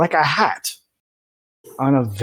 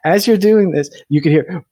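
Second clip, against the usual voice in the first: ordinary talk, nothing marked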